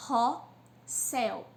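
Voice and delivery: "carrossel" slow in three beats, car, ros, sel; syllable by syllable. Shown as a demonstration. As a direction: pause after each syllable of "carrossel". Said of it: "tem três sílabas".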